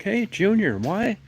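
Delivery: a hammed-up dad quizzing Junior about girls; prying dad. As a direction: play the nosy father grilling his son, high and eager. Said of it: "it's like".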